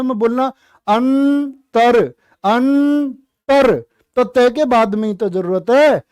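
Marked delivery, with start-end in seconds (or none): 0.0-3.8 अंतर drawn out and punched; stress on अंतर. Shown as a demonstration. Teacher stressing अंतर so students hear the र. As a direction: lean hard on अंतर, slowly. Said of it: "तो रे की कहां जरूरत है".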